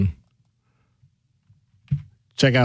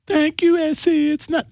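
much higher, put-on voice of a caller thanking the host; Kawanda voice. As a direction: squeak it out as the grateful listener Kawanda. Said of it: "that's my Kawanda voice".

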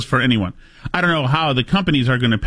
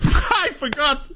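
high, drawn-out laughing words; helpless high-pitched laugh through the words; 11 semitones higher, much slower than usual. Real, not acted. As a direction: crack up while saying it. Laughs at bodega sound drop.

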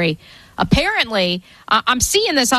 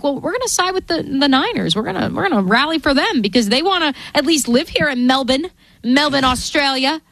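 put-on light Aussie accent, higher pitch; Australian accent; 3 semitones higher than usual. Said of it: "did you just give us your Australian accent".

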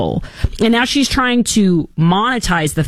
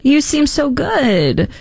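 mock flattery; 8 semitones higher, much slower than usual. Mocking Kamala flattering Joe.

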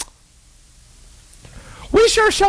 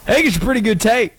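ordinary talk, nothing marked

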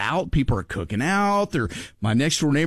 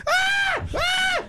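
imitated scream. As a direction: mimic the wife's panicked shriek. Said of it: "She's screaming".

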